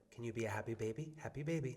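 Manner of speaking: put-on soft, coaxing baby talk; gentle coaxing. Dad softly coaxing his toddler son. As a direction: coax a fussy toddler softly. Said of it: "And I'll be like".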